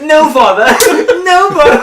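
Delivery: high, loud, laughing British princess voice; hammed-up English accent pleading with father; high British girl calling out, laughing; mock posh British Zelda. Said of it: "That gives you a British accent?".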